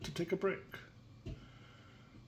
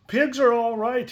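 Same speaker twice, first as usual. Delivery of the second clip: put-on higher, louder cheerful boy; bright, upbeat little boy line; boy's cheerful voice; 9 semitones higher, 9 dB louder than usual. Wendell's line voiced in story.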